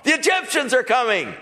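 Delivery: high, frantic calls of fleeing soldiers; fleeing Syrian soldiers in a high panic; panicked soldiers. Acted. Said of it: "Guy saying".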